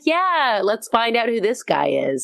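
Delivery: high, game-for-anything enthusiasm; mock-excited improviser egging friends on; playful eagerness. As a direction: leap in high on the first word, playing along. Playing along with friends as gremlin.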